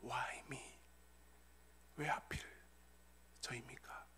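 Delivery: very soft, low, near-whispered plea; hushed question to God. Acted. Believer privately asking God why, hushed low plea. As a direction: ask it haltingly, barely aloud. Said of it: "하나님께 이렇게 물어볼 수 있습니다".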